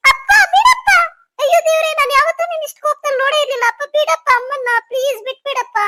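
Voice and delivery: high cry easing into urgent begging; distraught pleading. Acted. Daughter begging father to spare mother, distraught pleading.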